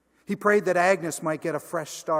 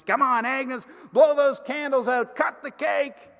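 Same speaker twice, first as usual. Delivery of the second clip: cheering; 11 semitones higher, 8 dB louder than usual. Retold or imitated. Hank cheers Agnes on.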